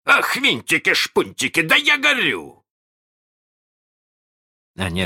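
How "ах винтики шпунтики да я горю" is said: high, frantic outcry; alarmed yelp. A narrator's reading. Hook on a scorching seat, crying out in alarm. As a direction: climb to a high yelp as you burn. Captain finds himself burning.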